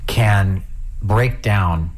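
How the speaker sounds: usual speaking voice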